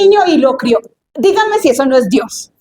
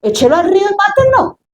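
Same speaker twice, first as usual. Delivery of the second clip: commanding princess; 3 semitones higher than usual. Acted. Pharaoh's daughter ordering a Hebrew baby drowned, mock imperious. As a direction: pronounce the sentence imperiously. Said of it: "ella decir".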